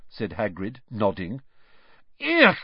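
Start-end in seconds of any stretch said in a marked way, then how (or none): 2.2-2.6 high, loud "ugh"; revolted high-pitched cry; disgusted squeal. A narrator's reading. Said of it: "squealed Lavender Brown".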